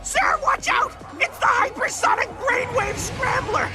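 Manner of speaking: high-pitched panicked warning; alarmed shout. Acted. Robot warning sir of a weapon, panicked cry. Urgent warning to his boss.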